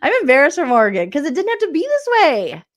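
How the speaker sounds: usual speaking voice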